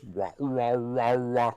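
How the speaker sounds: babyish mocking wah sounds; mock her silly camera noises; lightly imitating a wah wah taunt. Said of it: "She goes".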